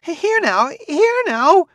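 high, breathless shrill cry; gasping woman. A narrator's reading. Flustered woman gasping at the crowd. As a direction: gasp it out in a high panicked pitch.

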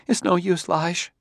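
high-pitched despairing woman. A narrator's reading. Wife telling husband it's no use, despairing. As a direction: give up, in a high voice.

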